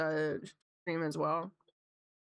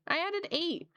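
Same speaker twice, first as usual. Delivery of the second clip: amused disbelief; 9 semitones higher than usual. Real, not acted. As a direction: say it again, amused.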